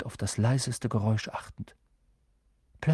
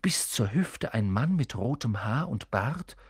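plain reading voice

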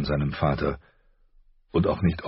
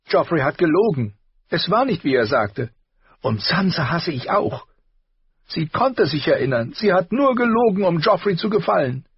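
put-on much higher, louder, indignant girl; tearful rage; 13 semitones higher, 5 dB louder than usual. A narrator's reading. Arya raging at the royals to her father, high and loud. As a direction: rant through tears. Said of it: "rot im Gesicht, schniefend".

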